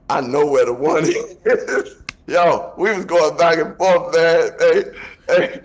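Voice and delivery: cracking up while retelling the fight. Real, not acted.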